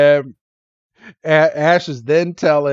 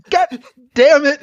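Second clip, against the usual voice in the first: high, louder exasperated curse; mock pissed off; 12 semitones higher, 5 dB louder than usual. Oshawott cursing after getting hit, mock pissed off. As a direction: imitate a fed-up little creature losing it. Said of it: "Oshawott just gets pissed".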